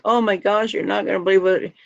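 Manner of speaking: high, fast, breathless panic; frantic excited self. Retold or imitated. Telling her friend Mary the news in a high panicked rush. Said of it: "I'm like".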